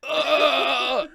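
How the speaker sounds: high, drawn-out puke noise; mock a stranger suddenly vomiting; strained, high "ugh" as if spewing; imitated retching. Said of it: "he's like".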